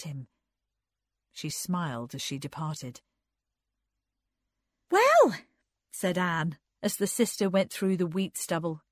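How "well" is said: high, loud exclamation; exasperated high-voiced character; Anne's exasperated voice. A narrator's reading. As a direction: burst out with it, pitched right up.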